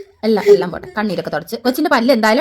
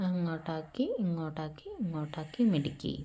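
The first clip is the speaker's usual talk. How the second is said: much softer, lower soothing guidance; gentle coaxing; 8 semitones lower, 14 dB quieter than usual. Real, not acted. Mother softly guiding daughter to wiggle the tooth. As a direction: murmur encouragement gently.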